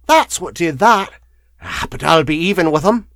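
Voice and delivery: put-on high, loud, bitter old woman; cackle, then rail in a crone's voice; wheezy crone. A narrator's reading. Scarred woman telling the lawyer of the vitriol, bitter and wheezy. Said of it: "she said in a wheezy voice".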